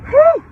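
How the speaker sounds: sudden high, loud exhale; sharp "whew". Real, not acted. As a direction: blow off anger in one whoop of breath. Catches himself amid angry rant.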